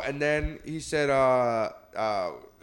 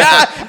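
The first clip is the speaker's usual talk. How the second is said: loud laughing yelp; loud laughing shout; 14 dB louder than usual. Retold or imitated. Recalling laughing aloud in the theater, a loud yelp. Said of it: "I was like".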